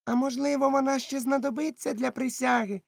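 put-on high, uneasy young sailor; anxious Dick. Dick fretting about the oath, high and uneasy. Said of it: "запитав Дік".

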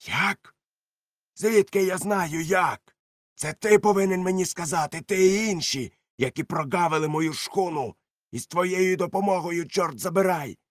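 high, loud defiant shouting; angry retort. Acted. George shouting back at Silver, defiant and loud.

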